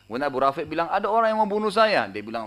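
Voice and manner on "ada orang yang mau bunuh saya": high-pitched imitated cry for help; retold alarm. Abu Rafi tells supposed helpers of his attacker, high retold alarm. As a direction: call out as if under attack, lightly acted. Wounded man cries for help.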